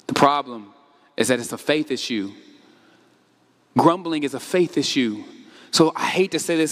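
ordinary talk

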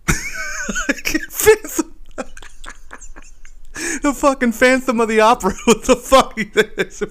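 high, wheezing laugh through the words; helpless laughter. Real, not acted. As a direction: barely get the words out for laughing. Cracking up at the mispronunciation.

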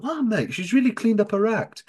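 high-pitched, put-on amazement; mock impressed mate. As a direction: act out an impressed pal in a high voice. Acting out character's surprised remark.

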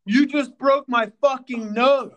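furious high shout, slow and loud; angry yell. Retold or imitated. Woman yelling at the man who hit her nose, furious shout. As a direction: yell it at the guy who broke your nose.

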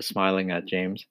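ordinary delivery

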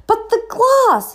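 high, slow stunned disbelief; shocked. A narrator's reading. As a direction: gasp it out in shock. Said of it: "the keeper was in shock".

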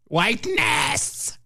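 slow drawn-out mocking repeat; mock echo. Echoing the article's word, slow and mocking. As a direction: drag the word out with disdain.

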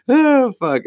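high, loud burst of "oh fuck"; loud exclamation. Real, not acted. Laughing at his own bombed joke, loud and high. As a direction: blurt it out, cracking up.

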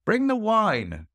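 high, slow call for wine; host shouting for the wine, high and drawn out. Acted. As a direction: draw out the order to the servants. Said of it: "the host cried out".

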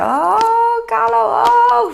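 put-on high, excited children's cry; excited kids. Classmates squealing at Carlo over the overflowing machine, high and excited. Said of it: "mijn klasgenootjes zeiden".

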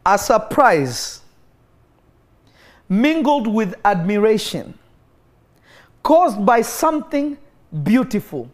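slow, drawn-out dramatic reading; slow emphatic reading. Shown as a demonstration. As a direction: savor each word slowly. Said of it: "Let me read it one more time".

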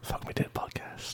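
slow breathy ASMR whisper. Pretend. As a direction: whisper it close to the mic, slowly. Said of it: "why are you trying to do A S M R?".

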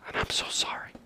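hushed, breathy whisper of sorrow; whispered apology. Acted. Abel whispering his apology to God. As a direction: whisper it like a confession. Abel's humble confession to God.

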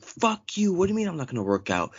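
high indignant snap; imitating her furious reply; mock angry outburst. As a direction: lash out at him. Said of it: "she was like".

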